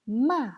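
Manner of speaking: "ma" loud, dropping sharply from high; fourth tone. Shown as a demonstration. Teacher reviewing the four tones, "ma" sharply falling. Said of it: "Quatrième ton".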